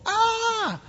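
very high "ah" of fright; terrified mock scream. Gideon reacting to the whoomph of fire, terrified scream. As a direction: let out a hammed-up, high-pitched scream. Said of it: "He's terrified".

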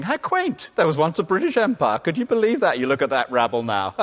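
put-on high, loud scoffing voice, sneering at the old empire; mocking snob. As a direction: play a future snob laughing at Britain's past. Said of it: "people saying".

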